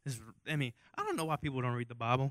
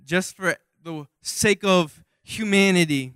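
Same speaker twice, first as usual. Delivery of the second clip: slow, louder mock dad; dad's voice; 4 semitones higher, 9 dB louder, slower than usual. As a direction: lightly play a solemn dad sacrificing his son. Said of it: "my dad would be like".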